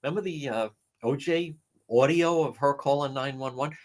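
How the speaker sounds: usual speaking voice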